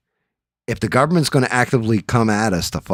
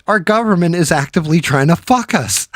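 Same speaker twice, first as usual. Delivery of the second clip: much higher, louder alarmed realization; higher, louder outraged realization; alarmed outburst; 10 semitones higher, 5 dB louder than usual. Retold or imitated. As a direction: blurt it like a shocking discovery.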